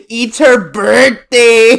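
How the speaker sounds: loud, slow mock Mexican accent; hammed-up Mexican accent with stretched vowels; cartoon iguana accent. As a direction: imitate a Mexican cartoon iguana, trying not to laugh. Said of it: "how this iguana talks".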